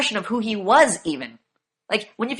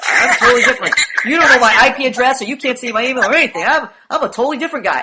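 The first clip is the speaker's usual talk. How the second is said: louder, laughing mock of a fake new guy; mocking a faker; 3 semitones higher, 6 dB louder than usual. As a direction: play the liar pretending to be new. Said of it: "pretends like".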